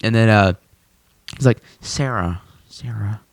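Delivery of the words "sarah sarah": soft, puzzled musing; mock pondering, very soft. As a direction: quietly search memory for the name. Acted puzzled recall of a name.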